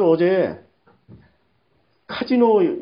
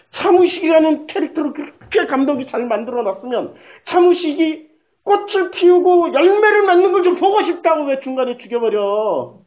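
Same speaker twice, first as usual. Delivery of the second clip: higher-pitched, indignant outburst; angry complaint; 5 semitones higher, 3 dB louder than usual. Retold or imitated. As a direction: protest hotly at a character being killed off. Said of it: "화가 나는 거지".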